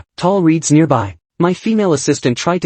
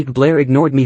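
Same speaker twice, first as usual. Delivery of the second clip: ordinary delivery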